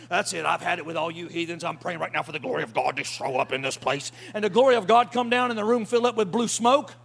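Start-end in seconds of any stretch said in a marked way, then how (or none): 0.1-4.1 lower, hammed-up holier-than-thou declaration; mock self-righteous zealot. Preacher mocking a churchgoer scolding heathen coworkers, low and pompous. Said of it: "you ain't gotta show up at the office and go".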